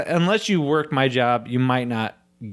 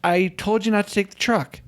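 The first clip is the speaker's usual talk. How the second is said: higher, casual reminder voice; light mock coworker; 7 semitones higher than usual. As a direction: say it simply, friend to friend. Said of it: "How fucking hard is it to be like".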